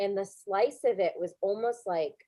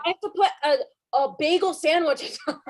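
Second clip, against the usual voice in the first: louder and higher, laughing through it; laughing mock shout; 7 semitones higher, 6 dB louder than usual. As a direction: ham up the panic while laughing. Joining the mock cooking joke.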